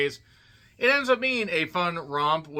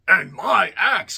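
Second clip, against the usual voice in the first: higher, drawn-out heroic cry; hammed-up movie quote; 6 semitones higher, 7 dB louder, slower than usual. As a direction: declare it slowly like a fantasy warrior. Quoting Gimli's line in mock intro.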